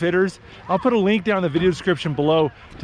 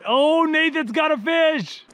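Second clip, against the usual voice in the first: excited; 9 semitones higher, slower than usual. Real, not acted. Fishing trip, excited call.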